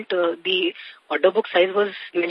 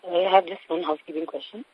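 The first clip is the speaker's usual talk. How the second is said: barely audible; 6 dB quieter than usual. Real, not acted. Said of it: "Your, your voice is very, uh, faint".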